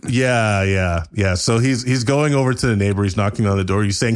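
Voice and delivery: ordinary delivery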